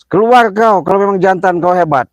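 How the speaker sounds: calling out the unseen coward; furious shout. Real, not acted. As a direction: bellow a challenge into the dark. Shouting a challenge at spirits.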